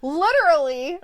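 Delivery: loud, high bright Literally. with laugh; Chris Traeger imitation. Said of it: "Rob Lowe's character, Chris".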